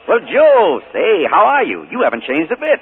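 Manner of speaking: loud, high, backslapping hello; hearty greeting. Acted. Uncle greeting Joe at the station, loud and hearty. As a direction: greet him like an old pal.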